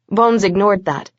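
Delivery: usual speaking voice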